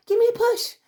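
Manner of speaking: hammed-up "Give me a push"; mock pleading. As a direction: beg like a silly child for a shove. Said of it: "You're over there like".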